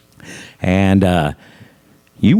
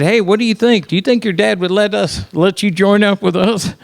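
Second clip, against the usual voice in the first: eager, higher quote; 8 semitones higher than usual. Retold or imitated. Frat guys asking eagerly.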